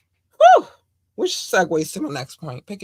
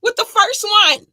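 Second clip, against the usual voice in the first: much higher, loud disbelief; exasperated outburst; 8 dB louder than usual. Real, not acted. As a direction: vent the frustration. Exasperated at J. Cole.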